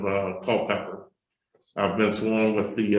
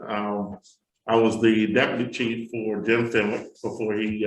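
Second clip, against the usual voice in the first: ordinary delivery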